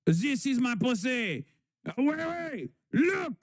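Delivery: put-on camp French-accented old lady, playfully; hammed-up French accent; mocking French old lady. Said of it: "an old lady pedophile being like".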